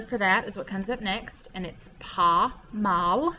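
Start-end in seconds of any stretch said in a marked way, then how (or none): 2.0-3.3 "pas mal" with the S of "pas" left out; silent S. Shown as a demonstration. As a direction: say "pas" as "pa", with no S sound. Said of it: "You don't say the S".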